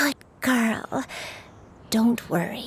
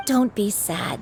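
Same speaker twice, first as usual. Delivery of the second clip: ordinary talk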